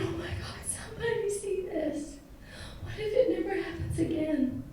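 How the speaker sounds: breathless high whisper; hushed, tearful disbelief; whispered awe. Retold or imitated. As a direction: hold your breath and whisper it. Whispered thought during the hug.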